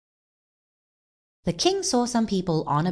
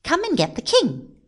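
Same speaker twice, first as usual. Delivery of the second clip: put-on higher, quick boy's voice; boy on phone; 10 semitones higher, faster than usual. A narrator's reading. Boy phones for the king's rescue, brisk. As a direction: make a brisk, high-pitched phone request.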